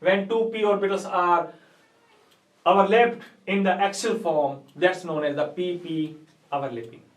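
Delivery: very slow, word-by-word reading out; slow dictation. Real, not acted. Teacher gives students the English definition to write, slow dictation. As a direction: dictate at note-taking speed.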